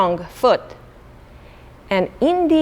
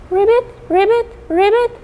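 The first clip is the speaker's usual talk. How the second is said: frog ribbit; 12 semitones higher, faster than usual. Acted. Teacher making frog sounds for the children. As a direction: croak like a little frog.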